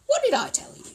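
high, loud told-you-so; triumphant I-was-right exclamation. Acted. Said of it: "he announced triumphantly".

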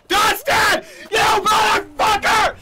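very loud, high, drawn-out yell; enraged scream. Real, not acted. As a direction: scream at the friend who betrayed you. Screams at friend who griefed him.

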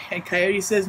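usual speaking voice

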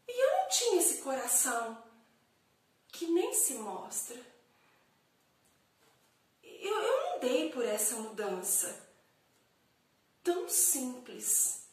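slow theatrical soliloquy with long pauses; dramatic poem recital. Acted. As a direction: recite it as an introspective monologue, swelling on key phrases. (declamação dramática de poema, pausada)

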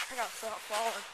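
normal talking voice

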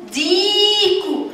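ديكو with a high, long-held ee; teacher's drawn-out ee in ديكو; long vowel. Shown as a demonstration. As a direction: stretch the ee in ديكو slowly. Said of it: "فيها مد بالياء".

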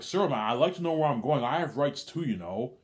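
loud, high protest about rights; indignant demand. Retold or imitated. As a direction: insist on your rights.

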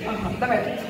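ordinary talk

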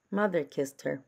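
usual speaking voice

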